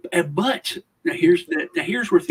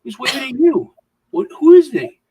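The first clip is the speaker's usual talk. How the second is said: climbs higher and louder to a near shout; mock freak-out over a stranger waving; rising panicked protest; 10 semitones higher, 8 dB louder than usual. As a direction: build from confused to alarmed yelling. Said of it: "And she's like".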